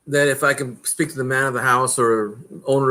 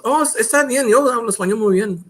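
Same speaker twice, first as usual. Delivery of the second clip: Spanish-speaking telemarketer; 8 semitones higher than usual. Retold or imitated. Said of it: "and the guy goes".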